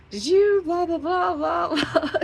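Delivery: loud, very high blaring parody of an ad; high-pitched, shouted blah-blah sales noise; mock ad blare. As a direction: shout it high like an intrusive advert. Mimics intrusive ad marketing.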